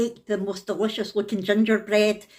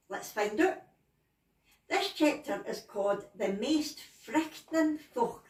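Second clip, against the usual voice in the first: put-on higher, cheery Scots auntie greeting the children; a hammed-up Scottish story host; Scottish storyteller persona; 3 semitones higher, 4 dB quieter than usual. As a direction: play a chatty Scots granny telling tales. Puts on storyteller persona greeting kids.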